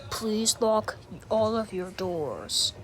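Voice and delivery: usual speaking voice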